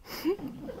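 quiet, high-pitched laugh to oneself; short high chuckle. Acted. Death amused by the poor sucker's end, soft high chuckle. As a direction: let a small chuckle escape.

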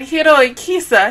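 ordinary talk, nothing marked

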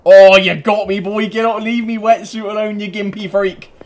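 high, loud trash-talk tirade; ranting taunts. Pretend. Ranting at player who killed him.

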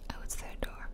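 usual speaking voice

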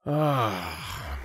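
long, theatrical sigh; exaggerated sigh. A narrator's reading. Bored chieftain before hearing Rin, exaggerated sigh. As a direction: heave a put-upon sigh. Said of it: "heaved an exaggerated sigh".

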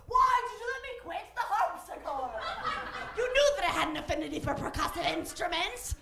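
high-pitched mock British complaint; aggrieved grown child, hammed-up British; British accent. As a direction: whine it in a put-on British accent. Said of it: "I don't know why he's British".

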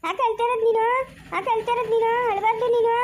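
put-on high-pitched cooing to a baby; singsong, high baby-talk voice. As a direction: soothe a crying baby in a high voice.

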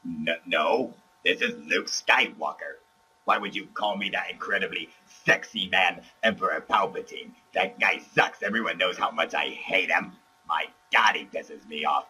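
quiet, gravelly put-on caller voice; fake Luke voice. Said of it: "Palpatine, you can drop the charade".

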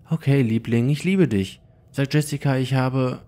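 höhere, fröhlich besorgte Mutterstimme; Mutter am Telefon. Acted. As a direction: eine liebevolle Mama, etwas höher gesprochen. Mutter verabschiedet sich am Telefon. (höhere, liebevolle Mutterstimme am Telefon)

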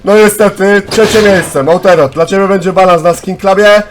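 loud, high-pitched results call; worked-up game host; excited announcement. Real, not acted. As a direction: announce the placing at full volume. Announcing third place to players.

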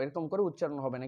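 ordinary talk

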